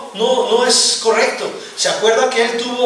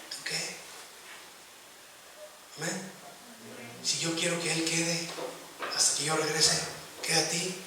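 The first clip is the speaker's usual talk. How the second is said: quiet rebuke; 2 semitones lower, 13 dB quieter than usual. Real, not acted. Soft rebuke to listener.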